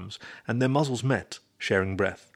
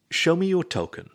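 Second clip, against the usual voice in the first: higher, insistent demand; 8 semitones higher than usual. A narrator's reading. Raccoon holds the disc behind his back, demanding. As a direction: press the demand, pitched up. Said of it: "he demanded again".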